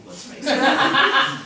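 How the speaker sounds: laughing it out, drawn out and high. Real, not acted. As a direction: giggle through the aside. Author aside mocking Aussies, laughing.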